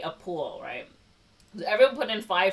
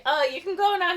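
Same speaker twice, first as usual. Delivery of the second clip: high, louder put-on voice; mock polite dismissal; 13 semitones higher, 6 dB louder than usual. She dismisses her escort in the lobby, mock polite dismissal. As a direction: send him off in a raised, acted voice.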